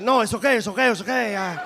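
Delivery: put-on light Puerto Rican accent, mumbled and laughing; Puerto Rican doorman accent. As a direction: shrug it off like the doorman. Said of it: "Great accent".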